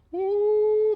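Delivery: high yelp. Real, not acted. High yelp at something else.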